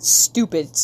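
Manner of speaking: ordinary talk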